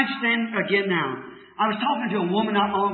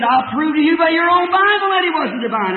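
arguing woman; 7 semitones higher, 4 dB louder than usual. Retold or imitated. Retold woman argues with preacher.

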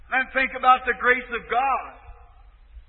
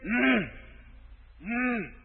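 dying man's moan; 3 semitones lower, 13 dB quieter than usual. Retold or imitated. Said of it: "He said".

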